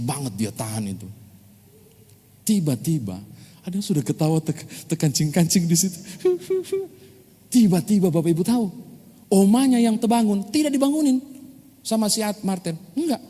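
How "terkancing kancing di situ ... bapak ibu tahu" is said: usual speaking voice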